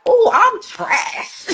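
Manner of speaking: long, high, comic wail of realisation; drawn-out self-roast. Retold or imitated. Wife recalling her first married year, drawn-out self-roast. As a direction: draw it out high, breaking into laughter. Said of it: "I was like".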